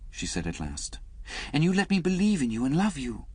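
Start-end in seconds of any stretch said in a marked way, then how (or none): 1.5-3.3 lightly voiced woman, higher; a hint of a wounded young woman, higher; Liz's voice. Reader voicing Liz to Alec, lightly higher.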